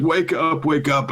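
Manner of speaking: desperate pleading. Retold or imitated. Norman pleading over corpses in dream.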